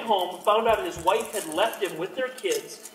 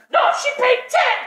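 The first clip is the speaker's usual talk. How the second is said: goblin wife; 10 dB louder, faster than usual. Acted. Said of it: "my wife comes out".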